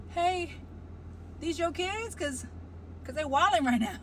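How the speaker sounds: high calling out to the car, faintly put-on; a light mock hail to the parents; half-acted high hey to strangers.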